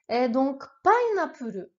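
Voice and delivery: ordinary talk, nothing marked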